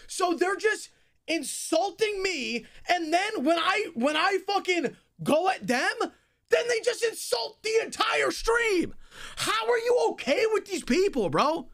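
furious shouting rant; berserk rage. Real, not acted. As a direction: yell at them, outraged. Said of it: "literally screaming at the top of my lungs".

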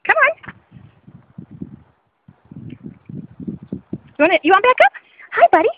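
high-pitched pet talk; coaxing a dog. Real, not acted. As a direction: coax the dog in a high, lilting voice.

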